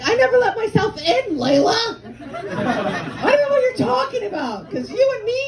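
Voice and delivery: high, hammed-up mock screaming; shrill-high comic protest; indignant retort. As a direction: wail back at mom in outrage. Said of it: "she was so mad".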